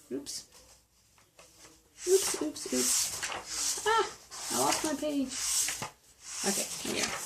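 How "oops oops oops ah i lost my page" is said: usual speaking voice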